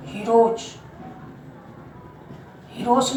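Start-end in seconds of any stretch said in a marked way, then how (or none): 0.1-0.6 "हीरोज" ending in j; final J sound. Shown as a demonstration. Teacher answering own question to class, final J sound. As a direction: say "हीरोज" as hee-roj. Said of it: "क्या बोलेंगे इसको?".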